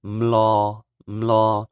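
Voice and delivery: "mlo" loud with hummed M before L; teacher drilling ml syllable; Hmong mlo. Shown as a demonstration. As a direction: hum then "mlo". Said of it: "all you have to do is say mm before you say the L".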